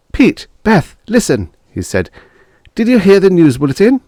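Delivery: officer bursting in on neighbours; urgent young officer. Acted. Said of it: "burst in".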